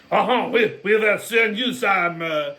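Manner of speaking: slow, hammed-up French accent; mock French accent. France offering soldiers, slow mock French accent. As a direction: drag it out like a lazy French official.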